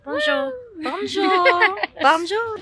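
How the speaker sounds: high sing-song bonjour; playful French greeting. Acted. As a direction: greet like a cheery Parisian.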